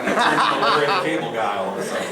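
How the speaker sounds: ordinary talk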